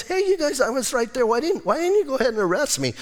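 high-pitched mock disbelief; incredulous challenge. Preacher voices Jesus asking why they waited, incredulous challenge. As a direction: protest in a high, quickening voice. Retold challenge to the arresting crowd.

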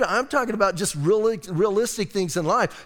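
normal talking voice

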